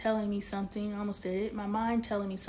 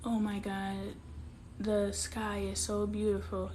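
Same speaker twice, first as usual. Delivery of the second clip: ordinary talk, nothing marked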